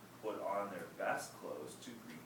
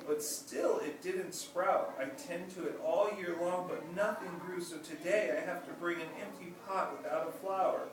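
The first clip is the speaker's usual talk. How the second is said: put-on higher, louder pleading child, a touch tearful; crying; 8 semitones higher, 4 dB louder than usual. Ping explains to the emperor, lightly tearful child voice. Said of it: "Ping started to cry and replied".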